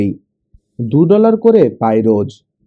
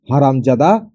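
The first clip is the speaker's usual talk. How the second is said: usual speaking voice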